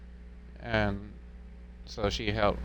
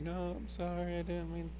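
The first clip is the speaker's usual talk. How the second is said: high, soft mock tearful apology; a hint of a tearful wife, high and soft; imitating crying wife; 9 semitones higher, 5 dB quieter than usual. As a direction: lightly voice the wife's teary sorry. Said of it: "she's crying, apologizing".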